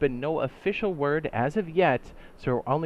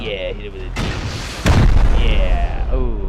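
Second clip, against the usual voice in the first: excited; 3 semitones lower, 11 dB louder, much slower than usual. Real, not acted. Urging during the shot.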